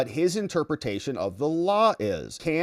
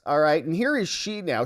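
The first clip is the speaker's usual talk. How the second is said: usual speaking voice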